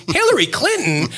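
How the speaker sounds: high, incredulous "Hillary Clinton?"; offended disbelief. Retold or imitated. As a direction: repeat the name in outraged disbelief. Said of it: "As a New Yorker, I was so offended".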